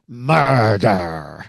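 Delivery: ordinary talk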